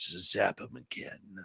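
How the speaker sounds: low, slow, menacing demon voice; demonic voice. Retold or imitated. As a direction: growl it like a demon. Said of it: "this demonic voice".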